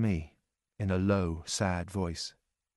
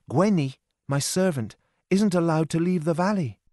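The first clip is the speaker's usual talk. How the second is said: put-on higher, louder girlish voice, anxious; frightened young Lorna; 8 semitones higher, 4 dB louder than usual. Lorna tells John of her danger, anxious and higher. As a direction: voice her like a scared young woman pleading.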